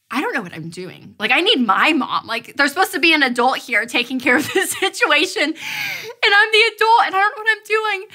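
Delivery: spiral into mock despair; overwhelmed. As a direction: mom admitting she's lost, rising to a high plea. Retelling her own helpless moment.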